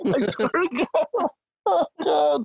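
breaking up with laughter; laughing till crying. Real, not acted. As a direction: lose it laughing. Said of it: "I'm crying".

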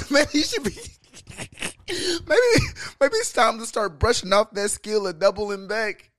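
high, loud, half-laughing tease; giggling as you egg him on; laughing while teasing. Real, not acted. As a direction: push the joke through your own laughter. Cohost teasing him through laughter.

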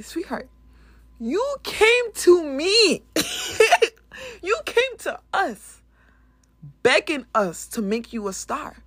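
a furious parent dressing down their daughter; scolding parent. Retold or imitated.

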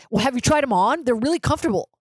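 high-pitched, mildly heated retort; getting heated. Retold or imitated. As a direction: butt in defensively, a bit worked up. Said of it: "started to get kinda heated".

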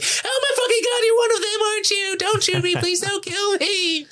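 very high, hammed-up panicked squeal; terrified fake hostage. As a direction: imitate a hostage pleading in mock terror. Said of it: "he hides his German accent".

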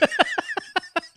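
sudden loud high-pitched laugh. Real, not acted. Laughs at own story punchline.

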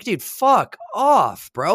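slow, high, stretched fuck off; exaggerated slow brush-off to a nosy plumber; drawn-out mock fuck off. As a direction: stretch it out in disbelief, half joking. Retort to nosy question.

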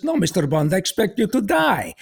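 accented film villain impression, rising high on the last phrase; Goldfinger. Villain answering the captive spy, in mock-menacing impression. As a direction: imitate a movie villain's foreign accent. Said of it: "he sounded like Goldfinger".

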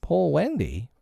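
put-on baffled boy repeating the words; puzzled echo. A narrator's reading. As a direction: echo it as a wondering question. Repeating the bird's cry, puzzled.

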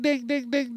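high sing-song ding ding; imitating a car chime. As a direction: chime it out like a seatbelt alarm.

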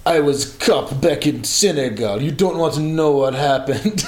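put-on Senegalese English accent. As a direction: imitate a Senegalese security guard's accent. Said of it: "these insane Senegalese security guards".

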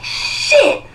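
sudden, very high alarmed cry; high startled exclamation; startled yelp. Retold or imitated. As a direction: caught off guard mid-throw. Nearly hits two old ladies.

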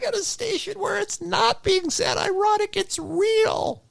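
mock them in a high squeak. Mocking radio station's earnestness.

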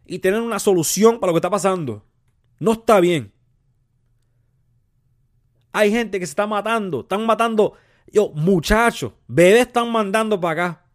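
loud, high-pitched exasperated tirade; heated, forceful bilingual scolding; angry rant. Acted. Heated bilingual rant about immigration.

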